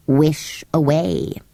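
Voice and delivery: low, slow and grudging; slow creature voice. A narrator's reading. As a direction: drag the words out lazily. Samiad answers Robert.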